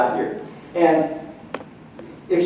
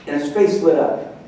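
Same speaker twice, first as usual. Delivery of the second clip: ordinary talk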